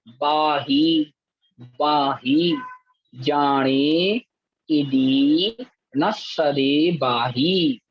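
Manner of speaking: each word drawn out slowly and repeated; teacher drilling verse words; slow word-by-word recitation. Shown as a demonstration. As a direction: model each word for the class to echo. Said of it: "आगे देखें".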